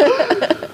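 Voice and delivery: sharp high gasp; high horrified gasp with a laugh. Retold or imitated. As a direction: gasp in horror. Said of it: "the gas wasn't me going 'Me too.'".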